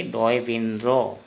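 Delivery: डोय बिन रो said slowly, syllable by syllable; slow model. Shown as a demonstration. Teacher models the Assamese letter name slowly. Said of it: "क्या उच्चारण करेंगे?".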